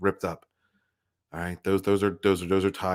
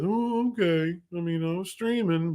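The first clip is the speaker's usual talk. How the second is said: much higher, put-on disappointed voice; disappointed friend; 9 semitones higher than usual. Imitating Culture Casino sulking about his stream, higher put-on voice. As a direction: act out the sulky disappointment. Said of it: "He becomes disappointed".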